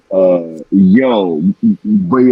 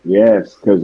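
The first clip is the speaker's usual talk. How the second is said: normal talking voice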